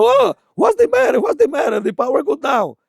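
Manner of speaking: very high, slow, startled English cry that settles down; high frightened foreigner asking what happened; bewildered friend. Retold or imitated. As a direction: squeak out the question in shock.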